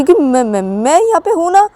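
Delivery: high, loud stammered objection; acted embarrassed outcry; flustered protest. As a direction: blurt it out, tripping on words.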